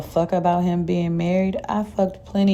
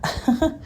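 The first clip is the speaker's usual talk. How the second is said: short, higher uneasy little laugh; nervous chuckle; 8 semitones higher than usual. A narrator's reading. Narrator hears the word husband, nervous chuckle. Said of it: "letting out a nervous chuckle".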